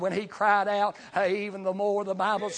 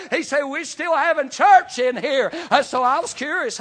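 higher and louder, building; rising preacher testimony; 4 semitones higher, 6 dB louder than usual. Acted.

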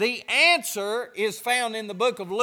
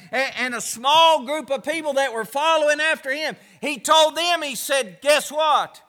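rising, louder preacher's build-up; building preaching; 3 semitones higher, 4 dB louder than usual. Acted. Pastor builds toward Jesus' words, preaching. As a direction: climb toward the big line.

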